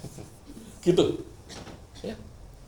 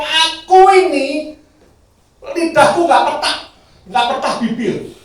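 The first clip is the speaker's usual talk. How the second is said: higher, louder, slow cry of unworthiness with a laugh; Isaiah crying out he is unfit; Isaiah despairing; 9 semitones higher, 8 dB louder than usual. Retold or imitated. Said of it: "Yesaya menyadari dirinya ngomong". (Yesaya berseru dengan suara tinggi dan keras)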